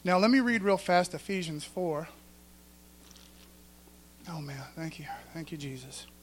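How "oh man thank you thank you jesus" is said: breathy whispered thanks to God. Real, not acted. Preacher whispering thanks to Jesus between points. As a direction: whisper it under your breath, grateful.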